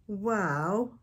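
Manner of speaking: plain reading voice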